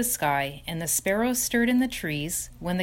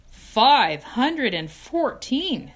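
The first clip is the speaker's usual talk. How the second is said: slow, louder, higher awe at "Five hundred and fourteen"; amazed echo; 6 semitones higher, 7 dB louder, much slower than usual. Acted. Wilbur repeats in awe.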